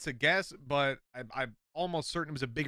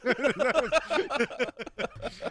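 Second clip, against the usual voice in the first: breathless high laughter; laughing; 9 semitones higher, 4 dB louder than usual. Real, not acted. Laughing at the slip.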